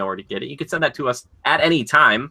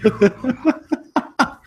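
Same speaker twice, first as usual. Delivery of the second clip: real giggle breaking out high; laughing at own joke; high burst of laughter. Real, not acted. Laughing after mock outrage.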